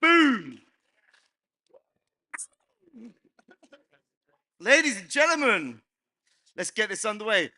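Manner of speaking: loud high emcee call; hyped announcer. Real, not acted. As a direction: pump up the room. Host kicks off panel.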